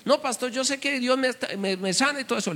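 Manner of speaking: high, soft pious protest; stubborn believer. Retold or imitated. Sick brother refusing the pastor's advice, high and soft. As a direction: insist softly that God will heal.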